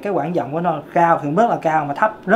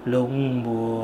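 low, soft sung chest voice on "lúc buồn"; 6 semitones lower, 7 dB quieter than usual. Shown as a demonstration. Vocal coach demonstrating chest voice to students. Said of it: "kéo cái giọng nó xuống ngực".

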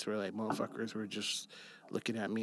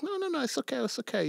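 eager host; 13 semitones higher, faster than usual. Retold or imitated. Retelling the man's reassuring reply.

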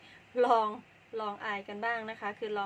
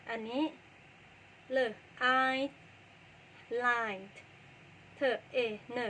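ordinary talk

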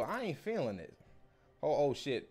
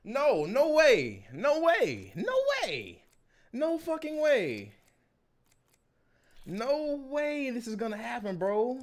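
high, loud, laughing no way; can't believe the pull, shout it; stretched-out no way in real shock; excited disbelief; 9 semitones higher, 7 dB louder than usual. Real, not acted. Big pack pull, disbelief.

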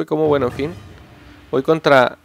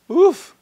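high relieved huff; 14 semitones higher, 6 dB louder than usual. Real, not acted. After a close round.

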